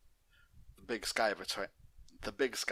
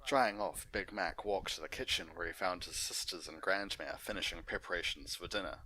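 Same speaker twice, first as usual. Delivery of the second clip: plain reading voice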